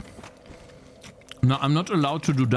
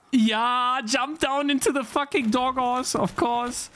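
high-pitched exasperated rant; real annoyed outburst; a little too mad; 9 semitones higher than usual. Real, not acted. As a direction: complain hotly, pitch held way up. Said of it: "I got a little too mad".

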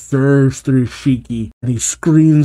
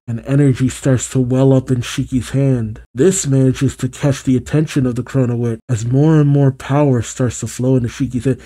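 ordinary delivery, nothing marked

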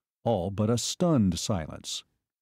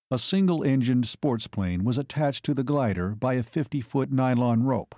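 plain reading voice

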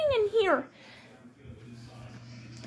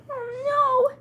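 ordinary talk, nothing marked

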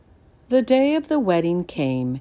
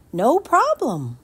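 put-on cheerful boy; 9 semitones higher, 5 dB louder than usual. Jameson repeats.

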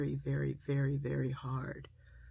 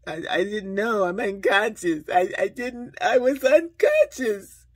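high, loud, whiny excuse-making; exaggerated stammering mock plea; mocking protest; 7 semitones higher, 12 dB louder than usual. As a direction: mimic someone wailing a lame excuse, building higher and louder. Mocks people pleading unconscious bias.

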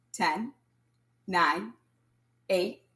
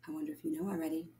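quick quiet aside; 2 semitones lower, 10 dB quieter, much faster than usual. Real, not acted. Teasing aside to children.

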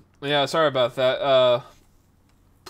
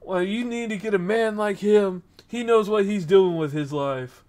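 higher, emphatic fatherly advice; imitated pushy dad; 6 semitones higher, 4 dB louder than usual. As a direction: lightly voice a dad making his pitch to his daughter. Dad pushing daughter toward him, retold.

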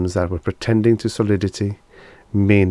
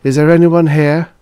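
loud, high call into emptiness; sustained searching shout; 8 semitones higher, 8 dB louder than usual. A narrator's reading. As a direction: call out for anybody.

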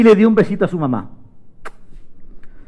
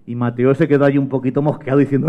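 plain reading voice

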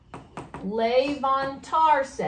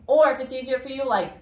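usual speaking voice

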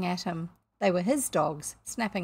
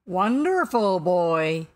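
loud, slow probing question; quizzing captain; 8 dB louder, much slower than usual. A narrator's reading. Captain guesses at stranger's identity, probing. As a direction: boom the guess, stretching it out.

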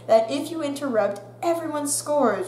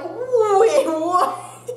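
higher, louder startled question; friends' shock; 9 semitones higher, 6 dB louder than usual. Retold or imitated. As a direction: friends hearing the tooth broke, shocked. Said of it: "they're all like".

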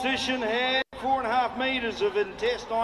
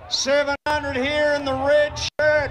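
auctioneer's bid calling; 6 semitones higher than usual. Real, not acted. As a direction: hold the price up to the crowd.

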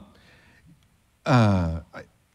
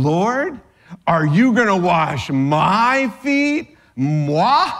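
indignant Peter; 3 semitones higher, 3 dB louder, slower than usual. Acted. Peter's indignant question voiced.

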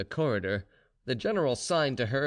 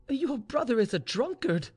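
high-pitched, repelled objection; fear and disgust; 12 semitones higher than usual. A narrator's reading. Mistress objects to maid's brother, fearful and disgusted. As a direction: object with alarm and distaste. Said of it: "with mingled fear and disgust".

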